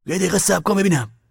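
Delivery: high, loud demand to wait; heated, insistent order; angry command. A narrator's reading. Man stops her in quarrel.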